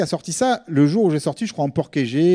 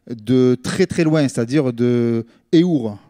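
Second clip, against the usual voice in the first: slow comic emphasis; 4 semitones lower, slower than usual. Acted. Joking about a nearby district.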